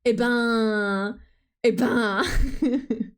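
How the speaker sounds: imitating a French shrug sound; a lightly voiced French "eh ben"; French noise. Streamer imitating a French "eh ben" she heard. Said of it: "that's a very French noise".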